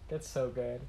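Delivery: normal talking voice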